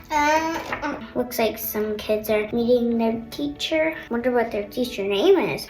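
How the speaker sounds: usual speaking voice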